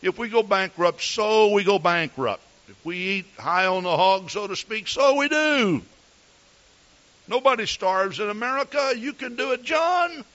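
breezy, carefree wife telling him not to worry; lightly voiced carefree wife; wife's voice. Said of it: "She says".

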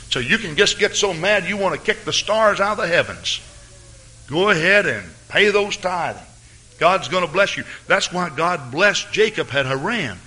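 high, ringing preacher's call to give; preaching at full force. Acted. Preacher urging the church to pay tithes, full-force preaching. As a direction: urge the congregation upward, preacher in full flight.

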